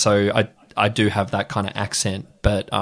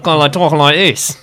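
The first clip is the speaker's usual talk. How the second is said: louder, higher mix of Cockney and Irish; Cockney-Irish blend accent; 6 semitones higher, 6 dB louder than usual. Shown as a demonstration. Showing how the Australian accent arose, Cockney-Irish blend. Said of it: "like, you blend that".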